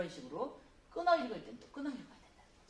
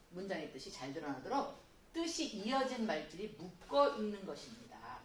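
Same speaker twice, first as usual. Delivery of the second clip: ordinary delivery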